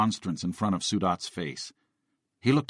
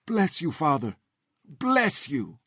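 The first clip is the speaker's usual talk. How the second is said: put-on Greek accent; 7 semitones higher, slower than usual. A narrator's reading. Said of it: "Falling to his knees, the man said".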